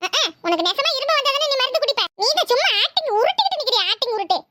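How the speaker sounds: bratty grandson mocking grandpa's acting; cheeky boy. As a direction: needle grandpa like a naughty boy. Boy teases his grandfather.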